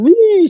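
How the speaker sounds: high, loud mock bawl; comic high-pitched "wee" of weeping; mock crying wail. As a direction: imitate bursting into tears. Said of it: "I'm crying".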